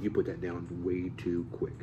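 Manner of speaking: slow, soft, affected coach voice; mock acting coach. Acting teacher critiquing a student, slow soft fussy voice. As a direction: lecture it slowly and softly, a fussy teacher. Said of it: "and you're like".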